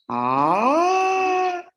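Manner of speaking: long held ah, high and rising. Shown as a demonstration. Teacher to class, demonstrating a high pitch. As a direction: slide up and hold the top. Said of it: "hagan un tono así hacia arriba".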